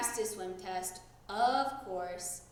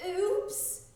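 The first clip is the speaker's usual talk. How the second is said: spluttered; 9 semitones higher than usual. A narrator's reading. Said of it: "spluttered Froggy".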